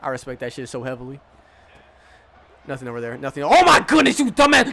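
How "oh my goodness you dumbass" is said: very high, loud yell of frustration; exasperated yell. Real, not acted. Gamer yelling at his defender, high and loud. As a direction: blow up at the player's mistake. Defender drops easy interception.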